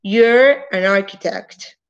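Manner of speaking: You're an architect, slow, the R of You're clearly sounded; careful slow You're an architect; slow contraction. Shown as a demonstration. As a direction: stretch You're and let its R ring. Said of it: "Entonces sería you're".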